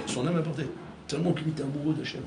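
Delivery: ordinary talk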